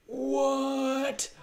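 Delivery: genuinely startled; shocked gasp. Real, not acted. Reacting to a creepy game cave with a gasp. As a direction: gasp then high, startled what.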